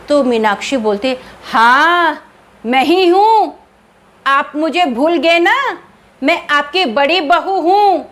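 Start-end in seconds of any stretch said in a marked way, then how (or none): 1.4-8.0 high, louder eerie ghost voice. Acted. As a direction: sing out like a haunting spirit. Ghost daughter-in-law speaks. (ऊँची, तेज़ भूतनी की आवाज़)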